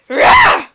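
very high, loud raging cry; explosive screech of anger; angry roar. Acted. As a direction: let out a frustrated "rah". Raging at stupid Dialga.

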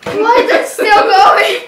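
laughing. Real, not acted. Sour taste lasting.

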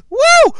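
very high, loud woo; real celebratory cry; excited whoop. Real, not acted. Celebrates in game.

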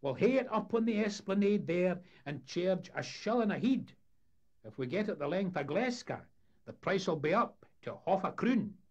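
high, loud Scots lecture patter; eager showman. Acted. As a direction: play a showman educating a paying crowd. Voices showman promoting the exhibit.

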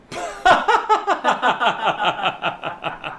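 high burst of laughter, trailing down; demonstrated laugh. Retold or imitated. Friend looking up at the red light, bursting into laughter. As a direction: imitate laughing it off. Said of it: "and just go".

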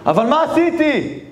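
high, slow, stunned question; a doomed rabbi pleading, slow and high; desperate plea. Acted. Rabbi shocked at decree.

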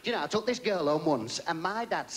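ordinary talk

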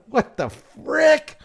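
what the frick, pulled long and high; stunned disbelief. Real, not acted. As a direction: drag the words out in shocked disbelief. Stunned reaction to gun law.

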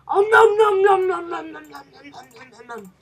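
loud happy chomping dying away; mock munching. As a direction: pretend gobbling, big then faint. Mock eating the fish.

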